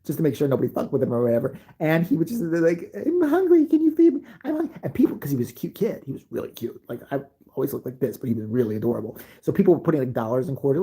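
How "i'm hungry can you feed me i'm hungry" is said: put-on high, whiny little-kid voice; a small boy pleading for food; little kid begging. Little brother begging on street.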